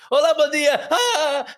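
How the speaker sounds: very high, stretched-out mock holler; really loud greeting. Greeting someone in that delicate morning condition, mock loud. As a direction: sing out a painfully cheery hello, laughing. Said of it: "You get a really loud bon dia".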